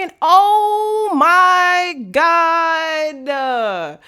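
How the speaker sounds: long drawn-out high oh my God; high, loud, stretched gasp of recognition; shocked realization. Real, not acted. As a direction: stretch it out in disbelief. Realizes who sent message.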